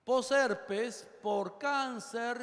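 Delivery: drawn out. Shown as a demonstration. Lecturer stretching a key term.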